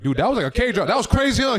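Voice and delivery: loud, high excited shout; amazed exclamation. Retold or imitated. Retelling the moment they looked at each other, loud and thrilled. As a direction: burst out as if blown away.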